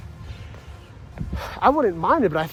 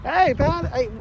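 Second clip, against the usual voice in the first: high, nervous pleading, pitched way up; mock panic; 4 semitones higher than usual. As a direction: beg them to stop the joke, rattled. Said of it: "And he's like".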